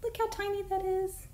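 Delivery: high cutesy itsy bitsy; cutesy coo. Real, not acted. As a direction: coo over how tiny it is. Cooing over tiny animal.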